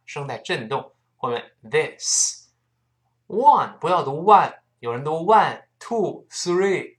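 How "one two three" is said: "one" mispronounced as "wan", then counting on; learner's mistaken "wan" for "one"; wrong one. Shown as a demonstration. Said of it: "不对啊，不是one".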